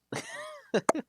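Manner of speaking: high pulsed giggle; short, high chuckle at your own joke; real giggle. Real, not acted. Streamer amused by a mangled name, giggling.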